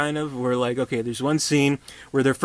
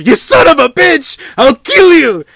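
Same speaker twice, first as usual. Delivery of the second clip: screamed, much higher, much louder; furious outburst; 19 dB louder, slower than usual. Retold or imitated. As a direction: explode in rage at the monster. Doctor snaps at monster.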